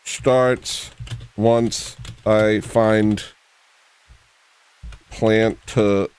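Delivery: slow announcement broken by long pauses; distracted, word-by-word delivery; slow, halting. Real, not acted. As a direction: space the words far apart while busy searching. Distracted searching as show starts.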